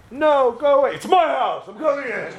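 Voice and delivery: high, heated back-and-forth; hammed-up high indignation; mock door quarrel. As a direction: argue it like a shouting match through a door.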